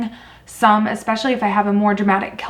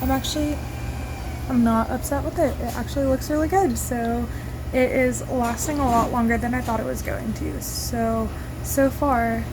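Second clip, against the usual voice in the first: tinny, distant phone mic; recorded on a phone, far off; phone recording; 2 semitones higher, 7 dB quieter than usual. Real, not acted. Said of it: "Sorry for my phone quality".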